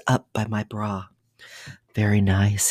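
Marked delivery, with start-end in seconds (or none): none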